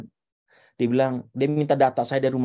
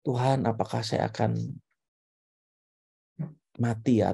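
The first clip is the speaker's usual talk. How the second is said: low, softer retold prayer; deeply serious prayer; 4 semitones lower, 5 dB quieter than usual. Retelling his prayer to God after the diagnosis, serious. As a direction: pray it earnestly, with long pauses. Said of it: "ini doa yang paling serius, paling dalam".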